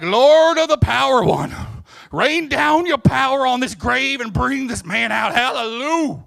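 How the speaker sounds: hammed-up booming prayer, high and drawn out; big, long-winded prayer. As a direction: parody a showy missionary praying at full volume. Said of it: "some big, long-winded thing".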